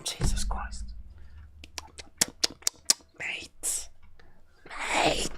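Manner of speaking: breathless hushed exclamations; stunned whispering at food; awed whisper. Real, not acted. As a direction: whisper in disbelief at how good it looks. Overwhelmed by crumpets.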